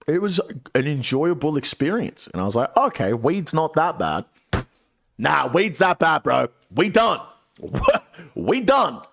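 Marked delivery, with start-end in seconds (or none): 2.8-4.2 lightly high-pitched reassurance; casual acceptance. Retold or imitated. Retelling his earlier change of mind. 5.2-9.0 high, punchy comic outburst; emphatic rejection. Real, not acted. Emphatically reversing that earlier verdict.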